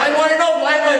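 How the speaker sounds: high-pitched mock yell; retold yelling. Demanding an answer from his sponsor, yelling. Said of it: "now I'm yelling at him".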